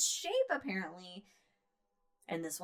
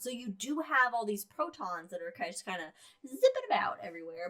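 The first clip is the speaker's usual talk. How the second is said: usual speaking voice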